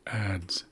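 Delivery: Ads with a clear dz at the end; ads example. Shown as a demonstration. Teacher giving an English example word. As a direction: stress the dz in Ads. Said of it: "like the English word ads".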